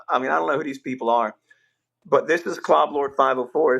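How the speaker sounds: ordinary talk, nothing marked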